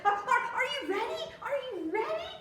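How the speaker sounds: high, loud, fast and eager; Froggy asking his parents eagerly; excited stammer. Acted. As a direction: bounce with excitement.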